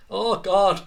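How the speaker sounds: loud, high exasperated exclamation; worked-up groaning outburst. Real, not acted. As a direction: cry out in exasperation at yourself. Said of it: "I'm getting so worked up here".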